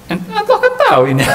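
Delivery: high mock know-it-all reply; cheeky wrong answer. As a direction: squeak it out like a smart-aleck. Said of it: "Enggak begitu jawabannya".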